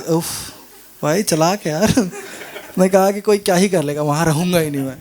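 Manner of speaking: ordinary delivery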